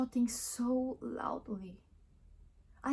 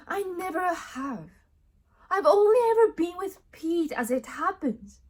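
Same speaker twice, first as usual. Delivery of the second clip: much higher and louder, emphatic protest; indignant denial; 10 semitones higher, 9 dB louder than usual. Acted. Woman denying she cheated on Pete, loudly indignant. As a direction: insist hard on your innocence.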